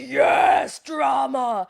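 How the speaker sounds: loud, high, relishing shout; mock thrilled cheer. Viewer loving the on-screen fight, exaggerated delighted yell. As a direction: cheer on the drama, hammed up.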